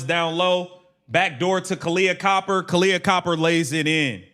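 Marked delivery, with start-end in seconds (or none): none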